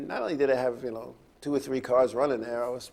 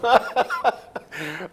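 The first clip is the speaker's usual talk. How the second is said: a long loud laugh going up; loud rising laugh; 7 semitones higher, 7 dB louder than usual. Real, not acted. Host still cracking up on air, loud laugh.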